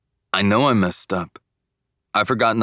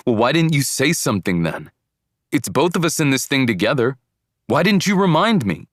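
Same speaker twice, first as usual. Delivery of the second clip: raised, indignant argument climbing higher; mad; 6 semitones higher, 4 dB louder than usual. A narrator's reading. Boyfriend argues back at girlfriend over reminding him, mad. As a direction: throw the blame back, louder at the end. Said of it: "to feeling mad".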